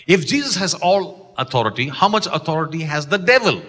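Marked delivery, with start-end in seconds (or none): none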